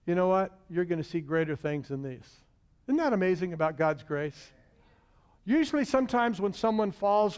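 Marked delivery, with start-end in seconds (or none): 0.0-2.4 gentle Jesus. Acted. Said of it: "He says".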